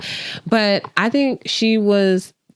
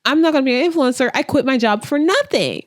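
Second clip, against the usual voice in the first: high-pitched imitation of panicked complaining; hammed-up spiral in a higher voice; mock spiraling; 7 semitones higher, faster than usual. Imitating Hannah fearing she quit her job, mock spiraling. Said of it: "Her spiraling kinda makes sense".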